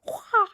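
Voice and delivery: high, falling imitation eagle cry; eagle screech. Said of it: "That's the eagle".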